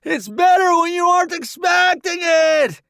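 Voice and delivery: high loud yell over his shoulder; shouting. Acted. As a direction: shout back while running. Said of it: "he shouts over his shoulder".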